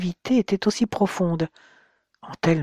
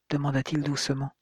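plain reading voice

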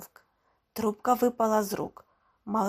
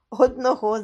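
plain reading voice

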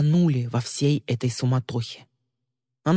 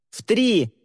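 high called-out shout; 7 semitones higher, 3 dB louder than usual. Acted. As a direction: call it across the room. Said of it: "крикнул он".

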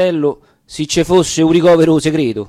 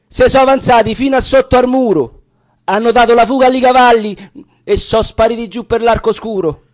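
much higher and louder urgent report; breathless alarm; 8 semitones higher, 4 dB louder than usual. Acted. Lookouts rushing in to warn the band, high excited alarm. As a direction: burst in crying the news of the dragoons. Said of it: "vengono su de corsa due fazioni e dice".

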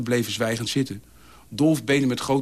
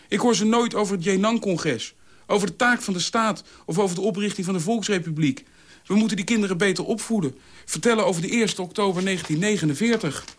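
hoge, drammerige betogende stem; prekende Dolf; 8 semitones higher than usual. A narrator's reading. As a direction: dram het erdoor. Dolf fulmineert. (licht gespeelde drammer)

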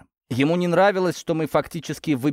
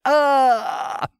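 loud, very high scream; scream of pain; 5 dB louder than usual. A narrator's reading. Worker screams at the next hammer blow, agonized. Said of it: "прокричал Тихон".

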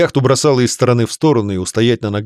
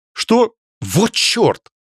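shocked; 11 semitones higher, much slower than usual. Acted. Shocked at iceberg.

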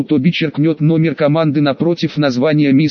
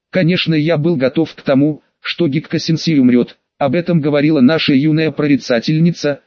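usual speaking voice

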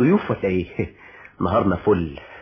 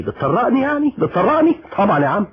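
much higher, indignant squeak; offended disbelief; 11 semitones higher, 4 dB louder than usual. Acted. Friend being sent out of the office, indignant disbelief. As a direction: act wounded at being thrown out. Friend told to leave the room.

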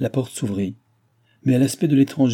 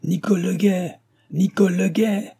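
high-pitched holler for someone far off; raised, urgent servant's call; calling out at full lungs; 10 semitones higher than usual. A narrator's reading. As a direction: shout the name across the yard. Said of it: "en criant de toute la force de ses poumons".

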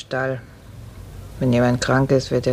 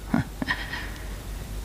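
bitter laugh; 11 dB quieter than usual. Acted. Nurse admits drinking.

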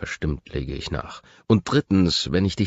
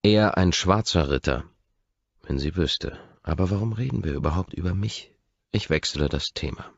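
plain reading voice

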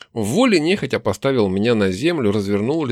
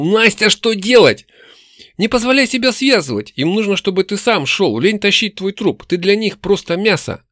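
raised, high urgent voice; urgent exclamation; 7 semitones higher, 4 dB louder than usual. Acted. Hero and demon Nastya in crisis, high and urgent.

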